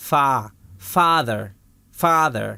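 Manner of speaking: father built up from a long "faa"; long AH sound. Shown as a demonstration. Teacher models the A in father, long AH. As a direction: say father as FAA-ther, spaced out. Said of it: "یہاں پر اے کیا آواز دے رہا ہے".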